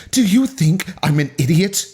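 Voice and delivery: acted angry accusation. A narrator's reading. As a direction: demand it in an angry character voice. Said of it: "True anger filled Haley's eyes".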